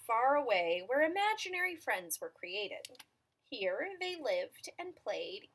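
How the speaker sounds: measured storytelling with stretched key words; picture-book narration. A narrator's reading. Reader telling children about an imaginary friend, storytelling voice. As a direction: read it to small children, pausing between phrases.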